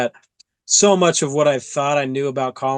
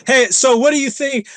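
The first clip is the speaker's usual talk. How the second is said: forced cheerful; 10 semitones higher, 5 dB louder than usual. Retold or imitated. Nervous chatter to distract himself.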